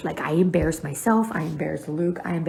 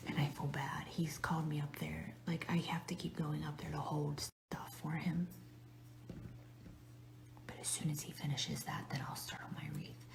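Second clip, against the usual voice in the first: hushed whisper so he won't hear; 18 dB quieter than usual. Real, not acted. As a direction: keep it to a breathy secret whisper. Whispering while husband works nearby.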